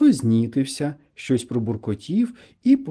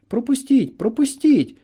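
high, insistent call through a crowd; pressing, high-voiced plea; urgent calling out; 11 semitones higher, 4 dB louder than usual. A narrator's reading. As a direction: call to be let through, pitched right up. Friend pushing through the crowd calls out.

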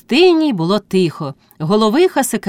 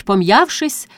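ordinary delivery

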